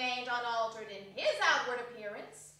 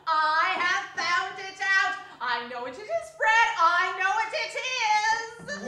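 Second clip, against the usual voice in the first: loud, laughing cry of discovery; laughing triumph; 4 semitones higher, 8 dB louder than usual. Acted. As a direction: shout the answer through giggles. Sister cries out, laughing.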